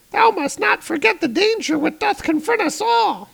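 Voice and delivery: very high-pitched, squeaky character voice; anxious royal advisor. Acted. Advisor warning his lord of approaching doom, squeaky high voice. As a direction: plead up in a tiny high falsetto. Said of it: "And his advisor's like".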